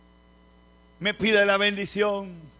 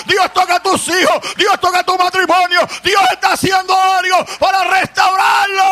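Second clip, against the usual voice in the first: much higher, louder preaching shouts building up; full-force preaching; 9 semitones higher, 12 dB louder than usual. Acted. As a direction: preach it out, crying to the congregation. (predicación a todo pulmón, mucho más aguda y fuerte)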